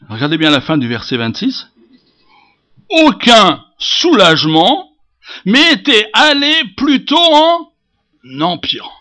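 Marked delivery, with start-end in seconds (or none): 2.9-8.9 loud, high, drawn-out declamation; forceful preaching. Acted. Preacher proclaiming she found no relief, forceful. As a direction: stretch and hammer each word of the verse.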